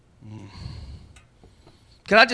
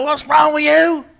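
higher, louder, slow accusing yell; accusing shout; 8 semitones higher, 10 dB louder, slower than usual. Retold or imitated. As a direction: bark the blame at them. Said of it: "Don't look at them and go".

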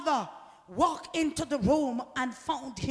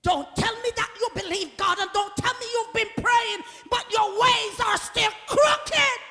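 louder, rising rebuke from the pulpit; full-force preaching; 8 semitones higher, 7 dB louder than usual. Real, not acted. Preacher challenging believers whose ways are crooked, forceful rebuke. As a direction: preach it hard, building to a shout.

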